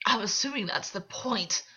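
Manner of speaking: a mild snarl. A narrator's reading. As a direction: bite the words out with a defiant edge. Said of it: "August snarled".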